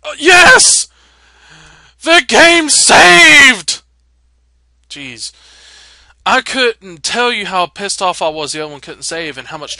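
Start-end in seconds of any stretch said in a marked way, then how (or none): 0.0-3.8 high, loud cry of relief; joyful shout. Real, not acted. Gamer shouting with relief when the game saves, high and loud. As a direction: yell it out, thrilled the save worked. Save finally works after trouble.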